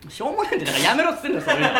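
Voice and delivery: high-pitched, laughing rebuke; exasperated retort. Acted. Comedian telling his partner to stop the gags, laughing exasperation. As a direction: shoot down a silly gag, half laughing.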